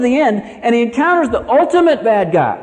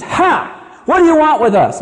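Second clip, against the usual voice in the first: higher, louder demon outburst; demon's scream; 6 semitones higher, 3 dB louder than usual. Acted. Preacher reading the demon's words to Jesus, acted outcry. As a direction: cry out as a frightened demon. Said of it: "The demon screams out".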